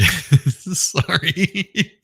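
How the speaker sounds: high, loud giggly sorry; laughing apology. Real, not acted. Apologizing to viewers for a crude remark, giggling. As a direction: squeak out the apology through giggles.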